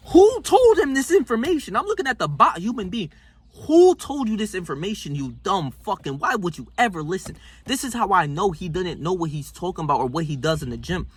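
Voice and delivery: normal talking voice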